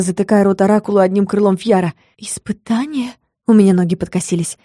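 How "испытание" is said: softer, higher disbelief; stunned question. A narrator's reading. Heroine hearing of a trial, knees giving way, stunned. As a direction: echo it faintly in shock.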